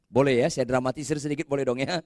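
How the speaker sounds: usual speaking voice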